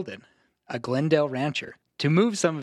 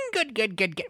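high giggly rapid repetition; gleeful approval; 8 semitones higher than usual. Acted. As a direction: lap up the praise, high and pleased. Gleeful lore master after giggle.

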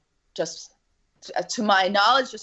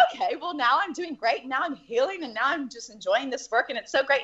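mock cheerful self-talk; 8 semitones higher than usual. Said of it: "I would think that".

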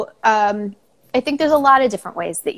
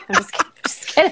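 laughing; 6 semitones higher, faster than usual. Real, not acted. Joking about a chair fight.

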